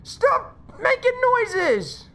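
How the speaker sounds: high, slow, drawn-out whine; whining plea. Real, not acted. Player begging game to stop noises, whining plea. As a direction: plead with it, stretched and high.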